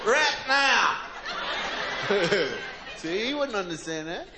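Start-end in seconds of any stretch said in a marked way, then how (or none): 0.1-1.0 loud, insistent Southern yell; Southern shout. Retold or imitated. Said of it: "Mississippi dude shouting". 1.0-3.0 audience-style chuckle. Real, not acted. Laughs after the bit.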